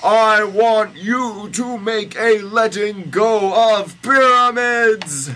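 slow, high, full-volume bellow; hammed-up heroic shout; yelling. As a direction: yell it slowly with every word held high. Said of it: "yelled Moses".

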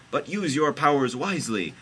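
high, loud cautioning voice; hammed-up prophet warning; Jesus' warning. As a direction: warn in a high, grand voice.

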